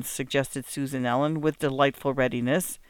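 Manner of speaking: plain reading voice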